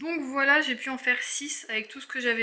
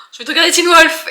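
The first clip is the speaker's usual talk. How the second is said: high, loud eager inner voice; excited craving; 7 semitones higher, 14 dB louder, faster than usual. Retold or imitated. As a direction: blurt it out like a sudden craving. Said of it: "je suis là en mode, oh".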